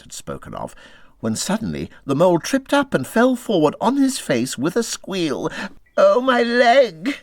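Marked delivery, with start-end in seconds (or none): 6.0-7.2 high, loud, slow yelp of pain; crying out in pain. A narrator's reading. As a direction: cry out as if the shin is hurt. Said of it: "he cried".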